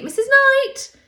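high, loud call across a waiting room; calling out a name. Retold or imitated. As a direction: sing out the patient's name. Nurse calling her name in clinic.

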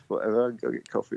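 usual speaking voice

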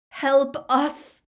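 high, loud plea for rescue; frantic cry for help; desperate yell. A narrator's reading. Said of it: "he yelled at it in mad desperation".

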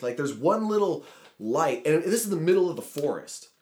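usual speaking voice

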